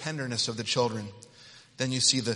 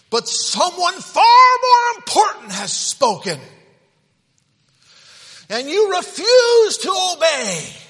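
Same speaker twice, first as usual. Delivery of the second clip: high, very loud slow shout; thundering rebuke; 15 dB louder, slower than usual. Acted. God's message to Judah, shouted high and slow. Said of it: "He's saying".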